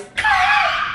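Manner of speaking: high, loud squeal of laughter; giddy screaming laugh as she speeds away; shrieking laugh. Real, not acted. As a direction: let out a wild gleeful shriek. Driving off from the scene.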